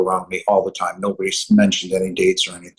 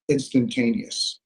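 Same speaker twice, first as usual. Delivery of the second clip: ordinary delivery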